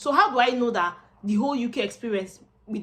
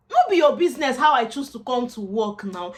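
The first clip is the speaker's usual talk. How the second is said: frustrated, louder; exasperated; 4 semitones higher, 7 dB louder than usual. Real, not acted. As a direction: argue back loudly. Frustrated reply to employers.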